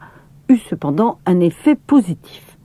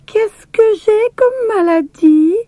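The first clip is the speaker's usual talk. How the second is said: put-on high, slow small child's question; little sister playing patient; little girl asking. A narrator's reading. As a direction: ask sweetly like a tiny patient. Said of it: "se renseigna la petite Ida".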